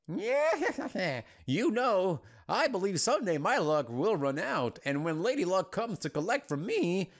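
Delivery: theatrical villain speech with high, swooping pitch; laugh, then boast like a showy outlaw; boastful cackling outlaw. Acted. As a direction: grandiose gambler's swagger. Voices Monty's boastful reply.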